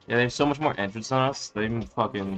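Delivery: ordinary talk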